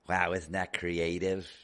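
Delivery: slow, deadpan sarcasm; sarcastic. Real, not acted. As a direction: drip with sarcasm. Sarcastic remark on plate.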